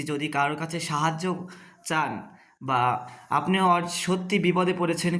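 normal talking voice

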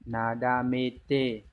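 "Nadamete" drawn out very slowly, each syllable stretched, falling at the end; very slow repeat. Shown as a demonstration. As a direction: stretch "Nadamete" right out so students can copy it. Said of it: "đọc là". (nhắc lại "Nadamete" thật chậm)

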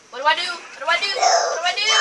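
loud, rising panic building to a shout; frantic cry for help; panicked. Real, not acted. Panicking when spotted in game.

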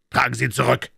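called-out order. A narrator's reading. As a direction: call out a firm order to the bearers. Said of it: "rief er". (lauter, gerufener Befehl)

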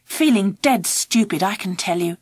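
plain reading voice

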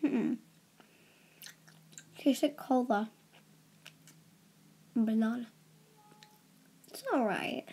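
words between chews; chewing while talking. Real, not acted. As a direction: mouth partly full of candy. Seen 1: Put gummy in mouth at -7; jaw moves, holds another.

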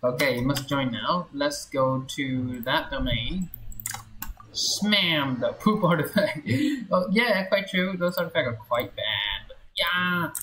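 ordinary delivery

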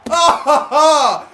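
high, loud burst of laughter; elated laugh. Real, not acted. Gamer after the big save, loud elated laughter. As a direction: laugh with relief and joy.

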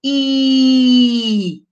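loud, held "I" with teeth together; vowel I, teeth together. Shown as a demonstration. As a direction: spread the lips and hold the "I". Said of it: "Faça aí".

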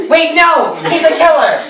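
high-pitched, hammed-up protest; mock alarm. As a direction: object in sudden mock panic. Herbert suddenly objects in retelling.

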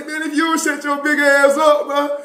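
high-pitched exasperated mock scolding; acted irritation, pitched well up; retold scolding. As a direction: tell off a crybaby, acted. Mocking the crying recruit.

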